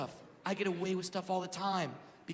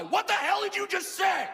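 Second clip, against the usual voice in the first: mock yelling; 8 semitones higher than usual. Yelling at the driver to get out of the car, angry. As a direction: challenge him to fight, half-hammed. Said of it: "I start yelling at this guy".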